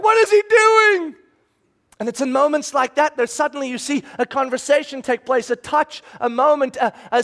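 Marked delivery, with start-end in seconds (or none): 0.0-1.2 high, loud, slow astonished question; stunned, can't believe what he sees; shocked disbelief. Retold or imitated. Onlooker watching Jesus walk into leprosy, astonished high cry. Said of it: "And you're like".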